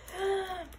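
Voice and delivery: short high gasp. Acted. Narrator gasping at Scapito's stolen things. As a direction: gasp in mock shock.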